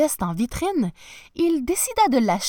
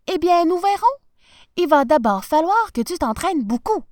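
encouraging wife; 8 semitones higher, 5 dB louder than usual. A narrator's reading. Wife urges professor to practise.

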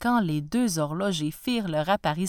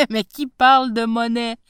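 laughing dismissal; 2 semitones higher, 7 dB louder than usual. Acted. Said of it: "se mit à rire".

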